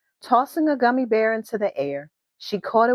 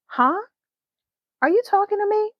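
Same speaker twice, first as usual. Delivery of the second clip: put-on young friend's voice; 13 semitones higher, faster than usual. Friend answers Talia on bus.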